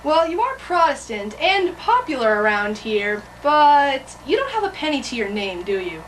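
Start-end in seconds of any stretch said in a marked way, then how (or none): none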